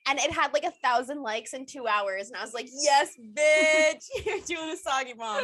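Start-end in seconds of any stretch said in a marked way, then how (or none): 2.7-5.4 loud, gleeful "yes" with a laugh breaking in; retold victory whoop, laughing; excited cheer. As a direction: cheer her on like she just won. Cheering mom's sake bomb post.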